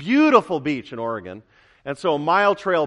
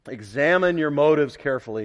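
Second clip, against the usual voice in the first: usual speaking voice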